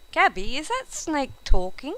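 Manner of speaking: put-on boy amazed the snake talks; astonished. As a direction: disbelief at a talking snake.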